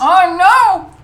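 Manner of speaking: high, loud cry of dismay; dismayed shout. Real, not acted. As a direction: yell out in mock despair. Opponent revives a fighter.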